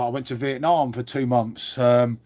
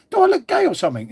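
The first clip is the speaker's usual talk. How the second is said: incredulous; 13 semitones higher, faster than usual. Real, not acted. Mock outrage at chat question.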